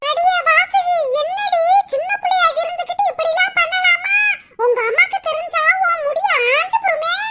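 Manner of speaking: high-pitched, alarmed telling-off; scolding. Acted. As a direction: scold the girl with rising pitch. Older woman scolds the crying girl. (பதறிப்போய் உயர்ந்த குரலில் திட்டல்)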